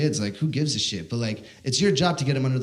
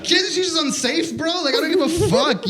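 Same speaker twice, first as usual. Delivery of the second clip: mock reply; 13 semitones higher, 4 dB louder than usual. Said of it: "And I'm like".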